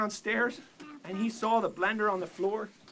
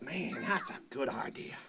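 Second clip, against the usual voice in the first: whispered thought; 5 semitones lower, 9 dB quieter than usual. Acted. Blender thinking to himself.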